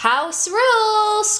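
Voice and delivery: high, slow animal chorus; lightly voiced animal chorus. A narrator's reading. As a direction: chant it together like small creatures. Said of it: "chorused the jostled lemmings".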